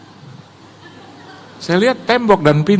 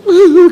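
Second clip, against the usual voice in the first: high, loud mock sob; imitated sobbing; 12 semitones higher, 10 dB louder than usual. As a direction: burst into exaggerated whimpering. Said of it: "gitu loh". (tiruan isak tangis keras)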